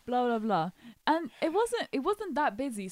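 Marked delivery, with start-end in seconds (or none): none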